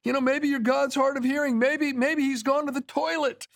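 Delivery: high, jeering taunt; mocking taunt. Elijah taunting the prophets of Baal, high mocking jeer. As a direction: needle the prophets about their silent god. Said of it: "Elijah's mocking them".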